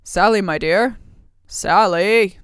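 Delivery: loud call into the next room; ogre calling out. Acted. Ogre calls for his missing wife, loud call. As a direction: shout her name across the house. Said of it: "he calls out".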